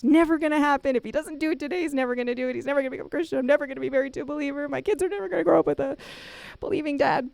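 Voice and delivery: high, rapid exasperated rant; mad. Retold or imitated. Wife rants after hanging up on husband, mad. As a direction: imitate a fed-up wife venting, pitch way up. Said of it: "of course she's mad".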